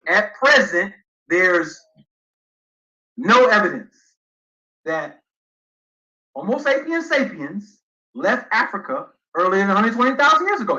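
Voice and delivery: halting, phrase-by-phrase emphatic reading; slow deliberate reading. Shown as a demonstration. Debater rereading the disputed passage to prove his point, slowly. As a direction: read slowly with long pauses between phrases. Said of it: "let me read it slowly".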